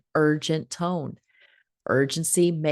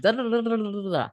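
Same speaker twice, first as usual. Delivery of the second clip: nagging parent; 5 semitones higher, faster than usual. Retold or imitated. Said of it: "I've told my kids a thousand times".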